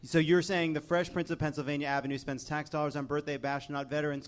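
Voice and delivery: ordinary talk, nothing marked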